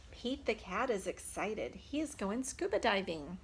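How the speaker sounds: ordinary delivery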